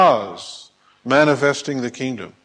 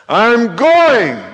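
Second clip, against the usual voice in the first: high, loud, eager cry; determined shout; 10 semitones higher, 8 dB louder than usual. Acted. Blind man casting off his coat for Jesus, shouting. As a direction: shout it out, bursting to get moving.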